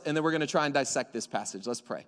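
usual speaking voice

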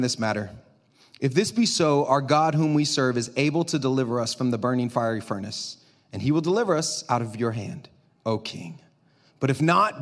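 low, measured answer to the king; calm, respectful. Acted. Young men answer the threatening king, calm and respectful. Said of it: "Did you notice their tone?".

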